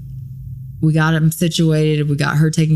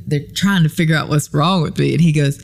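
ordinary talk

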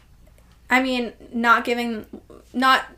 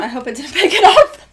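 laughing; 4 semitones higher, 9 dB louder, faster than usual. Real, not acted. Laughing after burping.

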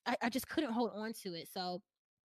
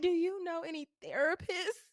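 timid question; 9 semitones higher than usual. Retold or imitated. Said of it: "I was like".

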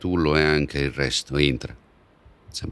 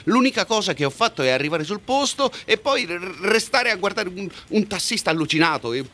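agitated outburst; 10 semitones higher than usual. Acted. Angry outburst at boss.

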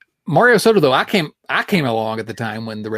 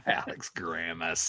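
real laugh into the name, much softer; 6 semitones lower, 13 dB quieter, slower than usual. Real, not acted. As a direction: say it slowly through a soft chuckle. Amused by co-host's answer.

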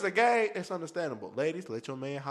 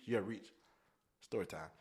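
ordinary delivery

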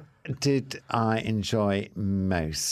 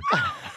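high giggle. Real, not acted. Laughs at own joke.

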